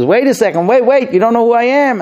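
urgent, high-pitched plea to wait; calling after someone. Acted. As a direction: call after a rider who is leaving. Calls after departing knight.

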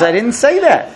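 acted frustration, pitch jumping up; frustrated protest. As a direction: protest it like an exasperated husband. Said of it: "he's getting really frustrated".

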